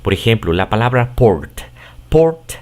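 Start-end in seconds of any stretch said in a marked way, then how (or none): 1.1-2.5 "port" said in English; English word. Shown as a demonstration. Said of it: "así es como se pronuncia". ("port" pronunciado en inglés)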